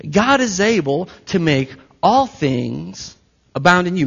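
usual speaking voice

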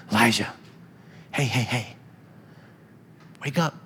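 soft, coaxing "hey, buddy", trailing off quiet; gentle waking angel. Pretend. Angel gently wakes exhausted Elijah, soft coaxing. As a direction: nudge a sleeper awake softly and kindly. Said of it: "I mean, it's like a".

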